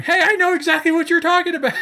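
high, excited mock voice; eager Chicago local. Chicago folks quoted eagerly.

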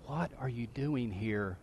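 slow, very soft whisper; hushed, drawn-out demonstration whisper. Shown as a demonstration. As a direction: whisper it to the person beside you. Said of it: "Turn to someone near you and whisper".

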